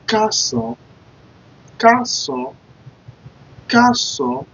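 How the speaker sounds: Kason high, ka-SON with open o; Kason modelled. Shown as a demonstration. Teacher modelling Kason for the class. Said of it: "I'll be like".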